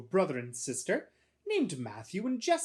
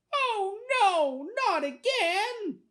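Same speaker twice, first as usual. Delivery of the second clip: high, loud, drawn-out wail; dismayed little boy; 7 dB louder, much slower than usual. A narrator's reading. Boy whose laces came undone, high slow wail. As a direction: wail in a small boy's dismay.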